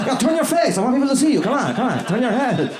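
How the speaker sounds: high, bossy direction; pushy photographer fan. Retold or imitated. Hitler directing the band photo, high pushy voice. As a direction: order them to face the camera. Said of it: "The guy's just, like".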